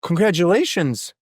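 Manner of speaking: high, rising mock congratulations; hammed-up villagers cheering on a neighbour; excited townsfolk. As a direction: gush like amazed villagers. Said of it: "townsfolk roll over and they're like".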